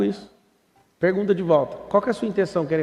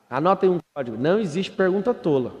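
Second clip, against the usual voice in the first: ordinary talk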